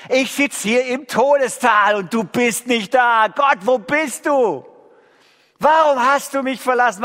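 hoch und laut, verzweifelt rufend; klagender Aufschrei zu Gott. Shown as a demonstration. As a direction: schrei die Klage zu Gott hinauf wie ein Psalmbeter. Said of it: "Lobpreis im Sinne vom Alten Testament geht so". (Psalmklage als Beispiel: hoch, laut, verzweifelt zu Gott geschrien)

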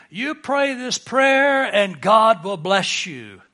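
slow, loud, emphatic mock promise; mock formula promise. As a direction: imitate a prayer promoter, drawing out each word. Voicing formula prayer promoters.

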